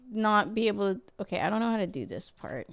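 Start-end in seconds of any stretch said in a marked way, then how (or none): none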